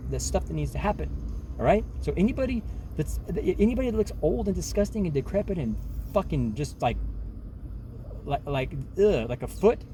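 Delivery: normal talking voice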